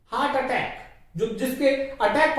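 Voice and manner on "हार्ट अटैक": usual speaking voice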